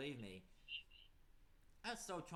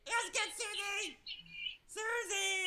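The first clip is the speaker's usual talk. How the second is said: high, slow battle cry; rally the team to charge; rallying shout; 14 semitones higher, 5 dB louder, much slower than usual. Acted. Calling out to charge.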